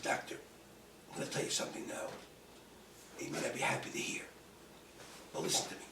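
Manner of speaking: low-volume, measured warning; quiet, firm confrontation. Retold or imitated. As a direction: hold it down, speak softly with pauses. Confronts doctor after grim news.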